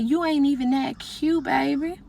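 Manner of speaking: drawn-out, soft teasing sass; slow mocking put-down. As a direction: savor the shade. Mocking inner comment.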